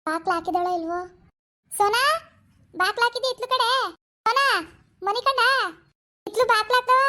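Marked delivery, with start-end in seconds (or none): 1.7-4.4 calling out. Acted. Mother-in-law calls daughter-in-law from bed. 5.0-5.4 raised, higher calling voice; pitched-up call to a far room. Acted. Calls again, no answer. 6.3-7.0 raised, higher calling voice; pitched-up call to a far room. Acted. Still calling daughter-in-law.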